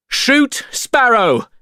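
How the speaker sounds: high, loud order; commanding shout. A narrator's reading. Norrington ordering his sailors to fire, loud shout. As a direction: bark the order at the men. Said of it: "shouted Norrington".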